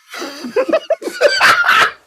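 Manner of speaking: shrieking high laugh; high squealing laugh. Real, not acted. Laughing at own joke.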